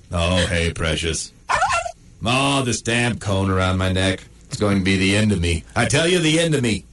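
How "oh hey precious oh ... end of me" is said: ordinary talk, nothing marked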